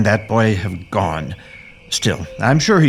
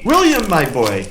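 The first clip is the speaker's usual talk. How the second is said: calling out; 8 semitones higher than usual. Acted. Calling lost boy.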